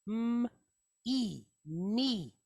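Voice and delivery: "m", pause, "e", then joined "me"; sounded out. Shown as a demonstration. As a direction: build "me" slowly from its separate sounds. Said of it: "we're gonna pronounce this as".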